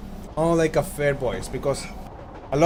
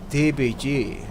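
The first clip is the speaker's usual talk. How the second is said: ordinary delivery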